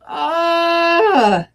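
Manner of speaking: high, loud shriek of alarm. Acted. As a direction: shriek in fright. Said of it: "shrieked Scrooge".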